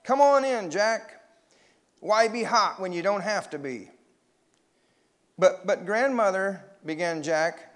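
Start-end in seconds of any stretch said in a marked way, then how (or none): 0.0-3.9 high, loud coaxing call; raised-pitch boyish urging. A narrator's reading. Larry calls Jack into the water. 5.4-6.6 higher, louder hesitant objection; mildly worried storybook protest; stammered protest. A narrator's reading.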